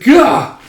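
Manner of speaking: high, loud cry of pain as he's hit. Acted. Warrior struck by Guts, high loud cry. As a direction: cry out as the blow lands.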